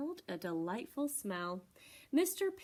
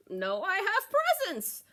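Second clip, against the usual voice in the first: slow, higher, louder proud goat; hammed-up proud goat; Mister Goat voice; 9 semitones higher, 6 dB louder, slower than usual. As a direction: insist on the presents.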